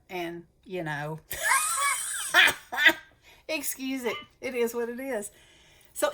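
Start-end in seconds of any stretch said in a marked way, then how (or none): 1.2-3.0 a loud high burst of laughter. Real, not acted. Laughing at her own Southern pronunciation, loud laugh. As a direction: crack up at yourself.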